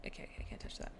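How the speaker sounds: hushed aside; whispered frustration. Real, not acted. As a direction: whisper it under your breath.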